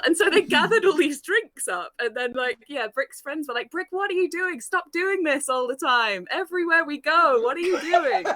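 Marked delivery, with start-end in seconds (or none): 3.7-8.2 1 mock Tails voice. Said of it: "Did everyone sound like Tails from Sonic?".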